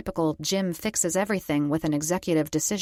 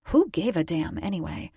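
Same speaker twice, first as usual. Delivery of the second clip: ordinary delivery, nothing marked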